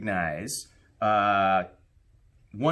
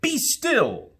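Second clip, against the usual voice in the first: higher, firm command to the monsters; commanding boy; 4 dB louder than usual. Acted. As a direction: stop the wild things with one order. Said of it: "Till Max said".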